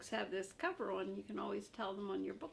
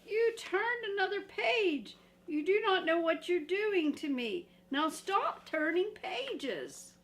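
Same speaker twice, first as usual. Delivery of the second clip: high, loud frantic puppet scolding; upset Grover; 10 semitones higher, 9 dB louder than usual. Acted. Grover scolds the reader again, high and frantic. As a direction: scold in panic.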